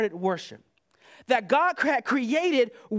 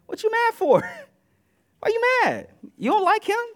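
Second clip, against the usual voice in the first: much higher, laughing through the mock question; laughing tease; 9 semitones higher than usual. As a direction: chuckle while poking fun at them. Teasing angry worshippers, laughing.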